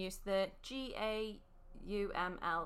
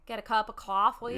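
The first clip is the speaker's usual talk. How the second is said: mock Boston accent; 8 dB louder than usual. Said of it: "you're doing Boston".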